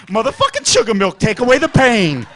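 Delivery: loud, over-the-top toast to sugar milk; loud mock reverence. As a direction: hail it like a savior. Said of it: "'cause you're like".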